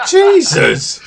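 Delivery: very high, loud burst through laughter; real laughing exclamation. Real, not acted. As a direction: exclaim while cracking up.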